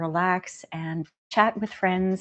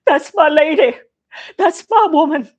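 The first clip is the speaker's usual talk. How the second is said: loud, high, crying-proud shout; tearful proud cry; 9 semitones higher, 11 dB louder than usual. Retold or imitated. Actress tearfully shouting pride at her friend in the movie. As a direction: belt it out like a proud friend in tears. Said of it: "she goes".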